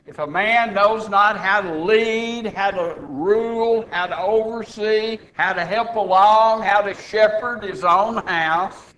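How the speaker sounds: punchy, emphatic pulpit delivery; forceful preaching. Real, not acted. Preacher telling the church who may be bishop, forceful preaching.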